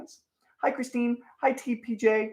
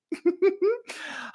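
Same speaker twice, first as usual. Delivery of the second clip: high giggle. Real, not acted. Laughs at own joke.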